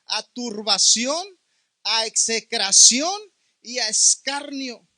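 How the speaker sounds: slow, loud preacher emphasis; preaching emphasis. Acted. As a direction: pound out each judgment one by one. Preacher stresses the three judgments.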